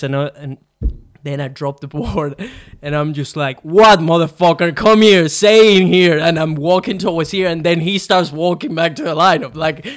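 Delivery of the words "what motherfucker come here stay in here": loud, high shout calling him over; furious shout at the surfer; angry challenge. Retold or imitated. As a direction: yell a dare to come fight. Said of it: "What an angry motherf".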